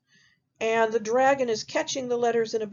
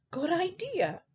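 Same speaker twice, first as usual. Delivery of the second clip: high pig voice; 9 semitones higher, 4 dB quieter than usual. Acted. Second pig voiced in story.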